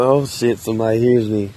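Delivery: ordinary talk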